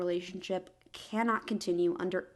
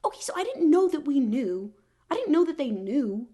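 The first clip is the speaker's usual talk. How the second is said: shocked; 5 semitones higher, 7 dB louder than usual. Real, not acted. Shocked reaction to reveal.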